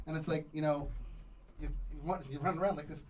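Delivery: ordinary delivery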